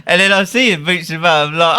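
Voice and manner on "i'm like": ordinary talk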